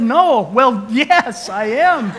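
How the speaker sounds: flustered blurt. Retold or imitated. Flustered dad cornered by nurse.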